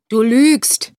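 a gruff, loud accusation; blustering. A narrator's reading. Man across the table accusing the drunk, blustering and loud. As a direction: bark it straight across the table. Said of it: "polterte der".

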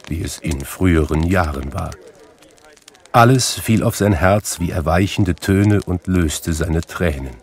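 usual speaking voice

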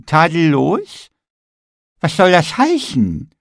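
high, slow, offended "Tadellos?"; affronted Nikolaus; indignant question. A narrator's reading. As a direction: gingerbread man bristling at the word. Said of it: "fragte der Nikolaus".

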